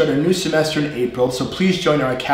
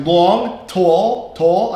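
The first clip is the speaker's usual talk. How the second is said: New York long and tall, higher, rising; East Coast accent; 4 semitones higher, 3 dB louder, much slower than usual. Shown as a demonstration. Teacher showing normal speech, East Coast accent. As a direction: list long, tall in a North Jersey accent. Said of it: "my normal accent".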